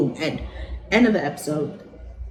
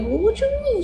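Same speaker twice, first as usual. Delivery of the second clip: defensive question. Retold or imitated. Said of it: "Shauna like".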